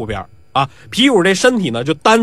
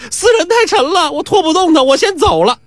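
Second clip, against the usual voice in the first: high-pitched woman calling as she runs; high, hurried parting excuse; fleeing woman; 11 semitones higher than usual. A narrator's reading. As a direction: toss it back in a high voice while making off. Woman calls back while running off.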